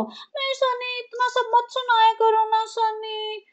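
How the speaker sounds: high, soft, slow whining; whiny mock wife. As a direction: pout it out like a spoiled wife. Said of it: "क्या नौटंकी मचा रखी है इसने". (ऊँची, धीमी, रोनी नखरीली आवाज़)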